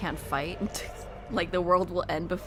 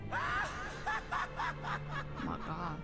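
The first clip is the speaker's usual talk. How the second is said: laughing shriek; 8 dB quieter, much slower than usual. Real, not acted. Shocked by the cutscene twist.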